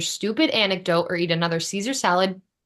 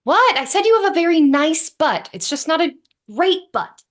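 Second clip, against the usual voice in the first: raised voice; 8 semitones higher, 5 dB louder than usual. Acted. Defensive retort in an argument.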